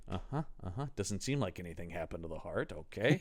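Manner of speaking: low, soft mumbled inspection; soft muttering. Retold or imitated. Perlman peering at the corpse, quiet muttering. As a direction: mutter as you check the body. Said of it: "looking in and going like".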